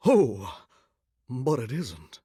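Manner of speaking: low, hushed aside; quiet letdown after the big outcry; deflated. Acted. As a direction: drop to a soft, low murmur. Second take, deflated.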